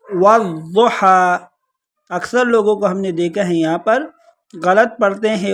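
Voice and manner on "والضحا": والضحا chanted very slowly, with a heavy ض; recited demo. Shown as a demonstration. Teacher showing students how to read it, slow recitation. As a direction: slowly intone والضحا, keeping ض thick and clear. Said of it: "تو اس کو پڑھیں گے والضحا".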